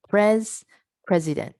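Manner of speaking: "Pres" alone, high and loud, then the whole "president"; stress on PRES. Shown as a demonstration. Teacher emphasizing proper syllable stress, PRES punched before "president". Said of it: "emphasize the proper syllable stress".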